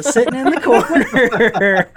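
slowed, laughing delight; real laugh breaking through. Real, not acted. Delighted reveal of Samuel Jackson.

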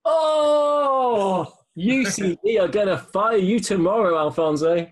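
high, loud outcry, then teasing; a hammed-up gasp of shock; mock outrage. Host teasing the guest for choosing Spain, mock outrage.

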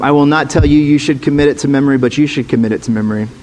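ordinary talk

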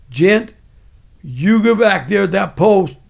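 imitated commanding shout; real loud. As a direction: bellow an order like a sergeant. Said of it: "he said it real loud".

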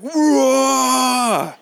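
put-on high, loud roaring yell like a small boy; imitated toddler roar. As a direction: let out the child's roar full force. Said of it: "he let out this roar".

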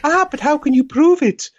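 high falsetto, cheeky challenge; hammed-up falsetto wife; mock woman's voice. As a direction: keep up the silly lady voice, defiant. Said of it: "doesn't sound like a woman's voice".

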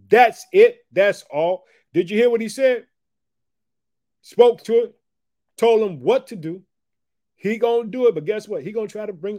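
high, loud exclamations; fired-up preaching. Real, not acted. Preacher exhorts congregation, loud and high. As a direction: drive the point home with fire.

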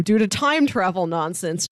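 ordinary delivery

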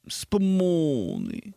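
put-on over-the-top Italian accent, very slow; exaggerated Italian. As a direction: stretch "Spumoni" like an Italian dad. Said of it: "Something my dad would say in Italian".

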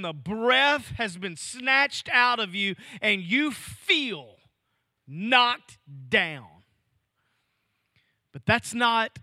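rising and falling preacher's cadence; preaching. Acted. Pastor preaching to his congregation, impassioned cadence. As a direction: drive the point home to the church.

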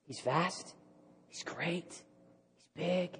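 very soft, near-whispered phrases; quiet, breath-held wonder; hushed awe. Real, not acted. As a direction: let each short phrase hang in reverent quiet. Preacher dwelling in awe on greatness.

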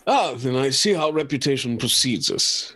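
put-on posh London accent. [name] to the group on their reputation, posh London accent. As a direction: play it in an upper-class London accent. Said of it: "You don't need to do the accent".

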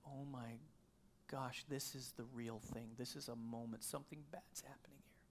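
hushed dread, barely voiced at the end; inner dread. Husband realising his wife is in danger, whispering. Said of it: "it's like that moment when you're like".

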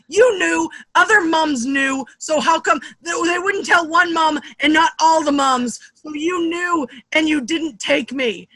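high, loud re-enacted kid's tirade; mock-outraged child arguing; retold accusing outburst. Acted quarrel with her mother.

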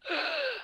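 sudden high startled gasp; a sharp, high intake of breath. Shown as a demonstration. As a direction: gasp in like the body flinching. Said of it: "their body goes like this".

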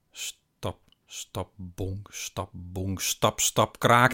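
stomp the steps nearer, ending on a loud creak; footsteps coming closer. A narrator's reading. Footsteps approaching in the hallway.

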